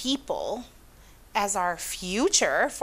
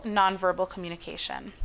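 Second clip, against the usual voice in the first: ordinary talk, nothing marked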